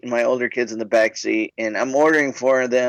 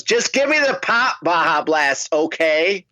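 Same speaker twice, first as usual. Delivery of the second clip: higher-pitched, insistent interruption; mock teenage exasperation, pitched up; imitated impatient teen; 4 semitones higher than usual. Fourteen-year-old leaning over to the clerk, mock impatient.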